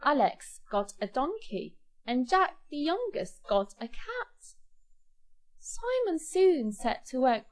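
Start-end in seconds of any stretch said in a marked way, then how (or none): none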